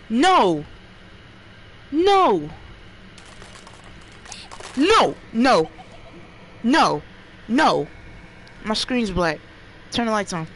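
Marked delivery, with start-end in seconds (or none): 0.1-7.9 repeated high, loud panicked screams of no; terrified gamer yelling no; scared screams. Real, not acted. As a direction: shriek no in real fright again and again. Real scare in horror game.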